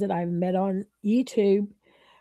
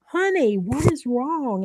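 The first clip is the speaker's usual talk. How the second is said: high, drawn-out sing-out of concern; imitated concerned friend; 7 semitones higher, 4 dB louder, slower than usual. Friend answering a bawling caller, concerned. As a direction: imitate a friend fussing over a crying caller, pitched up. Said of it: "bawling like a baby. And she's like".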